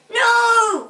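high, loud wail of dismay; anguished high outburst; crying out. A narrator's reading. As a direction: cry out in despair. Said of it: "cried Ping".

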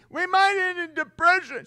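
high, loud, put-on panicky wail; mock panic. Panicked believer fearing depression, high and loud. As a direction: fret it out like ruin is here. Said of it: "They're always panicking".

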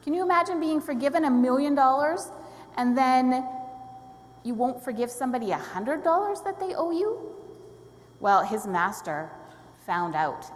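ordinary delivery

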